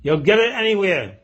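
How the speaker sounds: high, forceful orator's comeback; loud retort. Retold or imitated. Politician shouts back at heckler.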